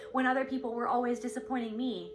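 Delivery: normal talking voice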